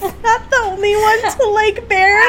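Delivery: high, loud laughing squeal; excited shrieking. Real, not acted. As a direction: shriek it in delight. Shrieking over fanfic.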